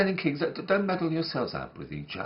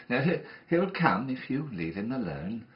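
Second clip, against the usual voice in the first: usual speaking voice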